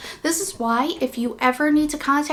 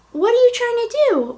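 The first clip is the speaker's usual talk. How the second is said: talking to the dog; 10 semitones higher, 4 dB louder than usual. Real, not acted.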